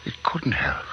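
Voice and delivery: breathy whisper of disbelief to himself; disbelieving whisper. Acted. Scientist refusing to believe his creature lives, whispering. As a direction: hush it out, not believing it.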